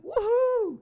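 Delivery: very high-pitched whoop; high, gleeful cheer; celebratory woohoo. Real, not acted. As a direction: let out a high woohoo of triumph. Celebrates finding the right answer.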